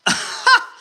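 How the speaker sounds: a quick breathy giggle at the chat; high unvoiced laugh. Real, not acted. Streamer laughing at funny chat comments, high giggle. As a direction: burst out in a short high laugh.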